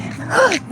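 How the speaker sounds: sudden high gasp of fright; startled gasp. Real, not acted. Startled by a dog while out walking, real scared gasp. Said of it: "I got scared of this dog".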